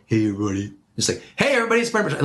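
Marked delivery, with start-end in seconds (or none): 1.3-2.2 high, lifted greeting with occasion; important-occasion greeting. Shown as a demonstration. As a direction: announce it like it matters. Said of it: "I want them to know this is important".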